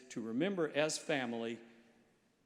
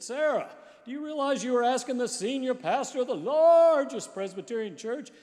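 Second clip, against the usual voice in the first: high, loud mock outrage at his wife; indignant husband; 10 semitones higher, 11 dB louder than usual. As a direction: play the offended pastor, loud and high. Pastor indignant at wife's request.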